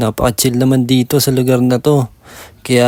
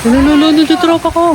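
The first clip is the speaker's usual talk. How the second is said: shouting for help; 14 semitones higher than usual. Pretend. Calls for help, friend drowning.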